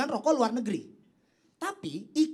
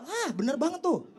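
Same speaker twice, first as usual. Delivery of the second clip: ordinary talk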